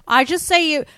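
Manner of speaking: loud, high, wide-mouthed Aussie drawl; bad Australian accent. Shown as a demonstration. Co-host coaches her friend by example, wide-mouthed Australian accent. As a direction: open the mouth wide for broad Australian vowels. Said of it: "open your mouth real wide like this".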